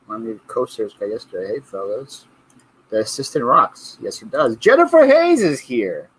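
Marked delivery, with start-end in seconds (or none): 4.6-6.1 drawn-out, high announcer-style welcome; excited call-out. Real, not acted. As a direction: call her name out like a host. Announcing a viewer's arrival.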